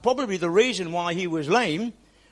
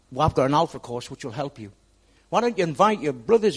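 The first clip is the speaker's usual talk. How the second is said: ordinary talk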